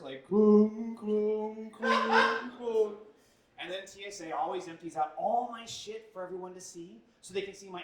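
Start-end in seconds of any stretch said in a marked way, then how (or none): 0.3-3.0 clunking spurs. Retold or imitated. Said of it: "sounds like the saddest pair of cowboy spurs".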